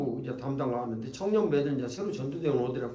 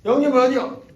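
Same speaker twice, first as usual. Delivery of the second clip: much higher and louder, laughing; excited loud call; loud laughing question; 11 semitones higher, 11 dB louder than usual. Retold or imitated.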